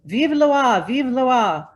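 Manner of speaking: loud, high cheering shout; cheering crowd. Acted. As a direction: cry it out like a roaring crowd. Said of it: "a roar rose from the crowd".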